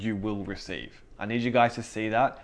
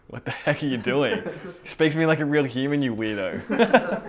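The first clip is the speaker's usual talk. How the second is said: mock exasperation, a bit louder; baffled friend; 5 semitones higher, 4 dB louder than usual. Friend answering an absurdly pious request, lightly mocking bafflement. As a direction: react as the weirded-out friend.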